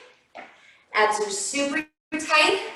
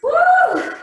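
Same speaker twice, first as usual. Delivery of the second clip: laughing whoop; 8 dB louder than usual. Real, not acted. Cheering mid workout.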